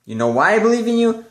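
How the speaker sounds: a hint of the hyped-up advisor; lightly voiced pep-talking advisor. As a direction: pump him up like an eager mentor. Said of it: "'cause he's like".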